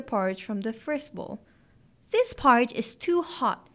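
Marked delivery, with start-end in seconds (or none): 2.1-3.7 lightly exclaimed complaint, slightly lifted; mild exclamation. A narrator's reading. Goldilocks tasting the first bowl of porridge, mildly exclaiming. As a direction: exclaim it like a mildly put-out little girl. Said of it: "she exclaimed".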